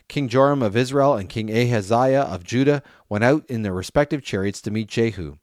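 plain reading voice

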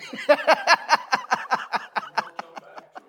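airy high laugh; breathy laugh. Real, not acted. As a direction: laugh it out, unvoiced. Laughs at own joke.

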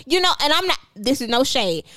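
usual speaking voice

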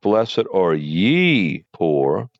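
ye lifted, very slow; Blessed are ye poor, drawn out with ye rising; slow reading that marks ye again; stress on ye. Shown as a demonstration. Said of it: "specifically ye".

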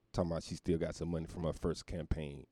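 normal talking voice

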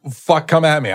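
loud, defiant challenge; defiant shout. Real, not acted. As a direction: shout it like a dare.

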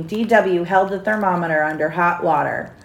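ordinary delivery, nothing marked

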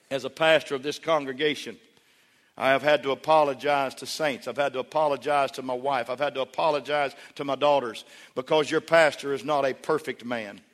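ordinary delivery

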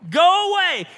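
high, loud shout; screaming out. Acted. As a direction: shriek it out suddenly. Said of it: "this voice screams out".

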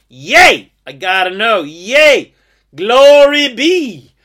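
loud, high, gleeful yelling; shout in glee. Pretend. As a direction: cheer the no like a jackpot. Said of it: "shout in glee".

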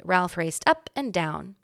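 usual speaking voice

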